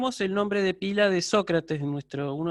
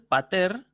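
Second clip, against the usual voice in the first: "pater" with the accent on "ter", pitched up at the end; stress on final syllable; 3 semitones higher, 4 dB louder than usual. Shown as a demonstration. Teacher repeating the Greek word for father, final stress on "pater". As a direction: land firmly on the last syllable of "pater". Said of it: "con acento agudo al final".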